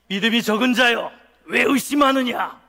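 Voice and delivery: loud, stern acted rebuke; scolding. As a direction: thunder it as a reproach. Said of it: "다 혼내는 모드로". (크게 혼내는 말투로)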